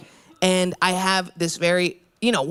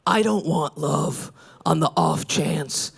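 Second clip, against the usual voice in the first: mock brooding man; 5 semitones lower than usual. Mocking avoidant men.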